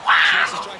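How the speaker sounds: sudden high yell of disbelief; shocked cry. Real, not acted. Fan watching the game cries out in shock. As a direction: blurt it out at the play. Reacting to a big play.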